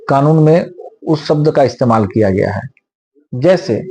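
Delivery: ordinary talk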